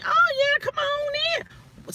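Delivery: sing-song come on in, mocking; mimicking the salesman. Mimicking the friendly technician at the door, high and sweet.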